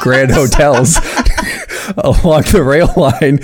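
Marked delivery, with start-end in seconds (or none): 2.0-3.5 reading through a giggle fit; can barely get the words out for laughing; cracking up mid-sentence. Real, not acted.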